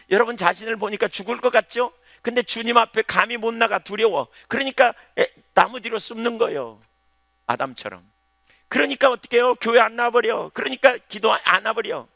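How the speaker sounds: high, loud pulpit delivery; fervent sermon voice; full-force preaching. Acted.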